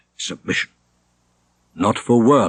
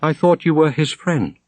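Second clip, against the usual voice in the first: high, cutting reproach; a girl's cold scorn; scornful; 7 semitones higher than usual. A narrator's reading. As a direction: sneer at his betrayal. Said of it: "with measuring scorn".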